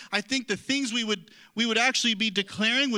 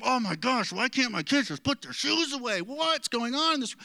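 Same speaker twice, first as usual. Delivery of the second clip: mock exasperated complaint; 3 semitones higher than usual. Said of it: "instead of going".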